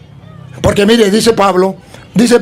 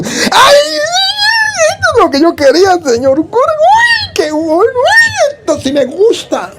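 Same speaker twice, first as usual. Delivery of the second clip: very high, excited squeals of joy; child's delight. Retold or imitated. Delighted child thanks the priest for his own Bible. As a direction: squeal like a kid with candy. Como si estuviera comiéndose un caramelo.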